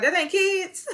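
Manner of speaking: high-pitched incredulous imitation; mock bewilderment. New York retold on hearing geriatric means elderly, high mock bewilderment. Retelling New York's confused reaction.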